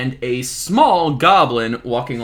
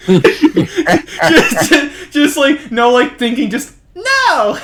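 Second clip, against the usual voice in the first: laughing refusal; 11 semitones higher, 6 dB louder, much slower than usual. Acted. Laughing flat refusal as guard.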